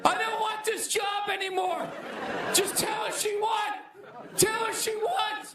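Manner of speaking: high, pleading, near-shouted give-up; panicked surrender. Retold or imitated. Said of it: "I would have been on the ground crying".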